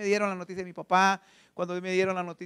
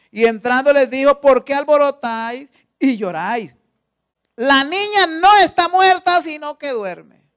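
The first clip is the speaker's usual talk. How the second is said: louder, emphatic reading; preached scripture reading; 3 semitones higher, 12 dB louder than usual. A narrator's reading. Preacher reading to the congregation, loud proclaiming. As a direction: proclaim the passage to the church.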